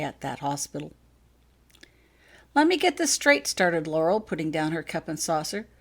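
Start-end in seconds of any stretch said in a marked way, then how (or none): 2.5-3.5 incredulous demand. Acted.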